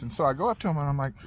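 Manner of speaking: ordinary talk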